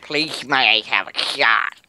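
slow, drawn-out silly voice; teasing, dragged-out mock politeness; annoying silly voice. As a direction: stretch out the polite request in an irritating put-on voice. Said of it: "stop saying that voice, 'cause it gets annoying".

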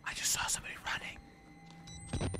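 hushed whisper so nothing hears; scared whisper. Real, not acted. Streamer hiding from a killer, whispering. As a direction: breathe it out, barely voiced. Scared in dark game area.

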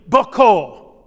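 loud Boko, falling; Twi reply. Shown as a demonstration. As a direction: answer in Twi firmly. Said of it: "your answer would be".

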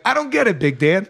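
high, puzzled mock voice; laughing imitation of a naive dupe; clueless victim. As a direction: start high and baffled, lift the last word. Quotes clueless victim being beaten.

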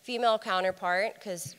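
normal talking voice

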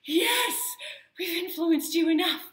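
loud, high, thrilled cheering; retold triumphant yell; excited shout. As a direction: cheer like he finally got it. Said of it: "And I was like".

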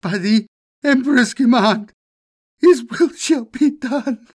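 high, loud and slow, still wailing; broken wail. Acted. Crushed governor swears obedience, wailing high and slow. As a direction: drag out the oath through tears.

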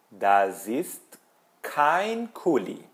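deliberate negative answer for learners; model negation. Shown as a demonstration. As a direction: lift and stretch "kein" after a pause. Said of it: "posso primeiro negar".

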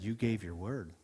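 soft, low, gentle reminder; quiet and gentle. Acted. Holy Spirit reminding a pastor of his promise, quiet and gentle. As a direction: speak it softly, like an inner voice. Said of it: "spoke to me so quietly and so gently".

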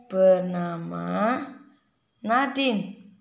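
slow, drawn-out introduction to copy; slow demo for a child. Shown as a demonstration. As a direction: lay out each word slowly for her. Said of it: "Nadine kalau baca gimana".

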